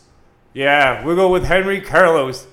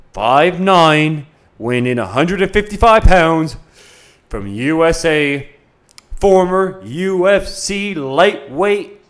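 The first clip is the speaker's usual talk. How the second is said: ring announcer; 4 dB louder, slower than usual. Acted.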